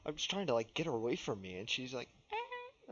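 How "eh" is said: tiny high squeak, soft; imitated cat. Telling how the cat answers back, tiny high squeak. Imitates the cat's little noise.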